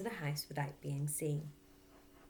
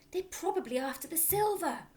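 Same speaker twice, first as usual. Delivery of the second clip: impatient urging; 13 semitones higher, 4 dB louder than usual. Acted. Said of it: "hissed the mother".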